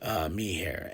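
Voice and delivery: father quietly encouraging his daughter, soft whisper. Retold or imitated. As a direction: whisper it gently so as not to disturb. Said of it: "I'm like".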